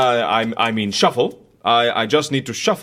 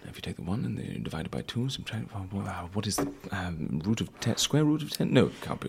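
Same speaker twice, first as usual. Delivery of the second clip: much softer, fast hushed reckoning; muttering to himself; 6 semitones lower, 12 dB quieter, faster than usual. Acted. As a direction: rattle through sums under your breath. Mumbling calculations to himself.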